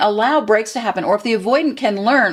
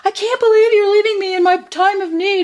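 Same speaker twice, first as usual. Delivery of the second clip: much higher, louder whiny protest, hammed up; mock wounded partner; 14 semitones higher, 4 dB louder than usual. Therapist mocking a hurt partner who is left alone, in a high, hammed-up whine. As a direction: play the clingy partner wailing in mock hurt.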